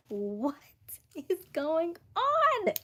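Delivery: slow, drawn-out, high amazement; stunned disbelief. Real, not acted. Finding her bag where the cat said, amazed. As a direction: stretch it out in disbelief.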